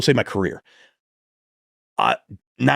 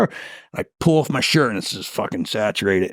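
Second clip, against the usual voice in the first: normal talking voice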